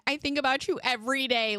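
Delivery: high-pitched mock tearful confession; mock bawling. Crying mother confessing to the ER doctor, mock bawling. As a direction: blubber it half-laughing, sending yourself up. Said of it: "I started bawling".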